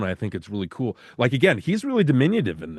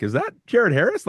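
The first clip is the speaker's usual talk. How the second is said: surprised recognition; 10 semitones higher than usual. Retold or imitated. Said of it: "I'm like".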